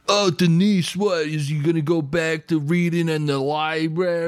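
mocking teen jock. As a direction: taunt the girl about the nerd. Voicing taunting teen in horror story.

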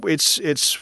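halting, stumbling for the words; tongue-tied false starts; stuttering. Real, not acted. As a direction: repeat and stall, searching for the phrase. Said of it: "I'm stuttering".

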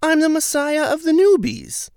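high, loud mock-grand voice; lofty parody of the boast; mocking Jose. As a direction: ham up his self-importance. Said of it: "tells Frank the same line".

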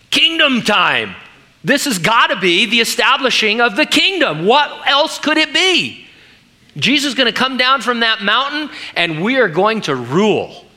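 acted-out elated conviction; excited, triumphant. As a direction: exult as if the long-awaited moment has arrived. Acts out Peter expecting the kingdom now.

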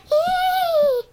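a high, loud little squeak of fear; squeaky frightened squeal. Retold or imitated. Girl squeaking at the devil in the wall, high squeal. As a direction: squeal like a scared kid. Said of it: "And I'm like".

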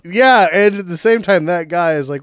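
ordinary delivery

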